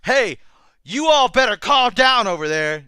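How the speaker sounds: high, loud, drawn-out "you all"; exaggerated shout across the room; mock stupid-sounding call. Shown as a demonstration. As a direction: holler it slowly to sound ridiculous. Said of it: "you sound stupid".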